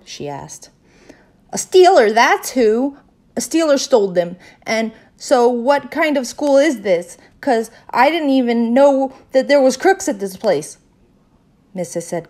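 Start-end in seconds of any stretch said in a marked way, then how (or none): 1.5-10.8 loud, high-pitched outrage; indignant outburst. A narrator's reading. Girl tells teacher about the thief, indignant outburst. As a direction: rant loudly at the injustice. Upset child accuses school of crooks.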